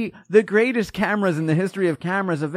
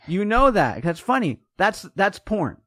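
usual speaking voice

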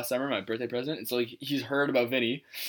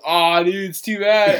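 put-on drunk friend; 5 semitones higher, 7 dB louder, slower than usual. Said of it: "and he goes".

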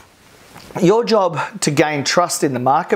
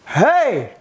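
mock cheer; 8 semitones higher than usual. Crowd cheer at football game.

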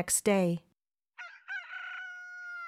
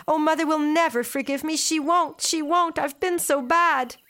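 put-on despairing girl; 6 semitones higher than usual. A narrator's reading. Girl despairs at unfinished spinning.

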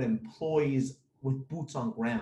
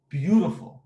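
Beautiful said louder with a rising tune; stress on beautiful; 6 dB louder than usual. Shown as a demonstration. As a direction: lean hard on Beautiful, lifting it at the end. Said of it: "but I'm saying beautiful".

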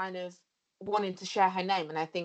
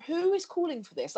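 incredulous; 10 semitones higher than usual. Retold or imitated. Incredulous quote about face masks.

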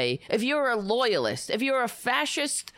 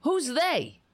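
sharp high outraged questions; who's us, who's they, pitched up in outrage; incredulous; 8 semitones higher than usual. Real, not acted. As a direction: fire back in disbelief. Mocking Trump's rally line.

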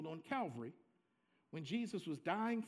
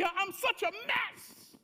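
loud, high, anguished cry to God; desperate shout of shame; exclaimed in despair; 12 semitones higher, 9 dB louder than usual. Acted. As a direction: cry out like a man who feels he is a mess. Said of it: "said with an exclamation point because he's feeling so bad".

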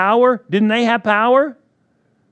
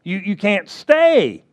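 commanding rebuke; 2 semitones higher, 4 dB louder, slower than usual. Retold or imitated. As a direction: order it out.

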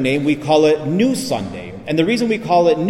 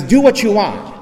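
irritated retort; 6 semitones higher, 4 dB louder than usual. Retold or imitated. Dying man snaps at the priest.

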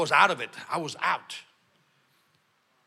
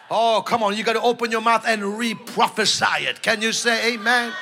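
normal talking voice

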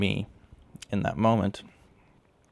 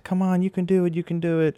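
encouraging; 11 semitones higher than usual. Retold or imitated. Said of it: "and everyone's like".

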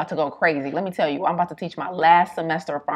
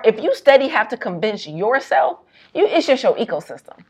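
ordinary delivery

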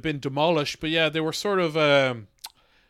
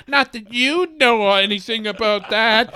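catty; 5 semitones higher, 4 dB louder than usual. Acted. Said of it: "you get very catty with them".

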